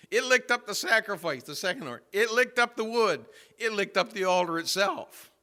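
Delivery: loud, high, punched phrases; forceful preaching. Real, not acted. Preacher recounting fire consuming the altar, forceful preaching. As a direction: pound out each item like a preacher.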